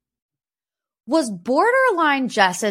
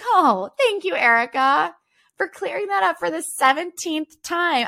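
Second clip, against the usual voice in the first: high, sugary mock gratitude; sarcastic thanks; 8 semitones higher than usual. Mocking Erika for retelling the story again. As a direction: thank her sweetly while rolling your eyes. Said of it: "It's like".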